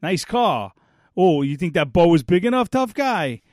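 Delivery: mocking taunt. Brothers taunting him on porch.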